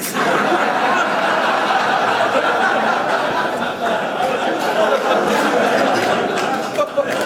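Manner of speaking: long loud laughing fit; real roaring laughter after the joke. Real, not acted. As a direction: crack up loudly. Audience roars after punchline.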